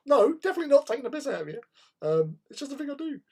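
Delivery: high, loud, fast nervous protest; panicked denial. Retold or imitated. Skinny sixteen-year-old pleading with a big ex-bouncer, high fast panicked denial. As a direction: gabble it out like a scared skinny kid. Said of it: "this, like, sixteen-year-old skinny thing".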